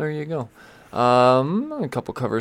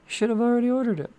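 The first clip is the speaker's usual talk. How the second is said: jokey high-pitched put-on voice; mock reminder; 12 semitones higher, much faster than usual. Host teasing viewers about their orders, high mock reminder. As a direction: squeak the reminder out much higher.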